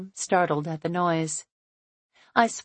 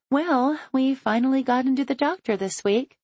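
cheerful woman; 8 semitones higher than usual. A narrator's reading. Chloe voiced, cheerful.

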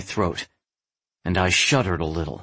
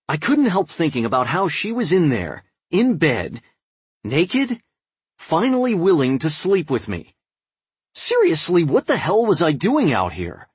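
higher, louder, worked up; agitated; 8 semitones higher, 3 dB louder than usual. A narrator's reading. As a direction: fight the temptation, agitated. Agitated, tempted inner monologue.

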